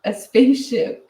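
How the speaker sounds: high-pitched excited exclamation, drawn out, not truly screamed; playful retold scream of amazement; mock excited scream. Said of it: "they're all screaming".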